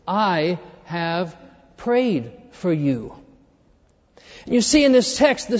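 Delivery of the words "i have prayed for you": very slow, soft prayed for you; tender reassurance. Acted. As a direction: linger on prayed, gently. Jesus quoted, reassuring.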